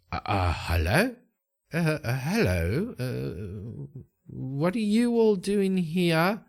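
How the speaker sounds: startled, hesitant and rising in pitch; high, wavering, nervous questioning; frightened mage. Acted. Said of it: "very frightened".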